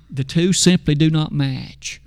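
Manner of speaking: ordinary delivery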